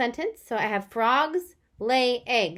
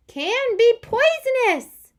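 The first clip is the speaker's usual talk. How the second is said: much higher, louder, slow; emphatic excited read; 12 semitones higher, 8 dB louder, slower than usual. Shown as a demonstration. Teacher rereading with the exclamation. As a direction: belt it out with excitement. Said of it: "I wanted to emphasize it".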